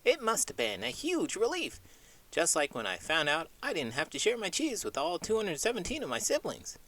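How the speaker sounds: high little mouse voice, cheeky; a cheese-loving mouse cracking a joke; small squeaky mouse bragging to the host. Acted. Mouse puppet Sonny speaks.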